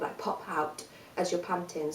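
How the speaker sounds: usual speaking voice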